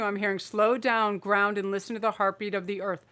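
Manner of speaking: ordinary talk, nothing marked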